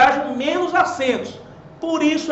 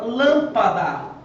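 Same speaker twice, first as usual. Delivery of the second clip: lâmpada slowly, first syllable stressed; lâmpada as LÂM-pa-da; much slower than usual. Shown as a demonstration. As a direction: hit a long LÂM in lâmpada. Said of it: "cuja sílaba tônica é a antepenúltima".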